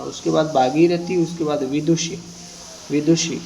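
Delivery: ordinary delivery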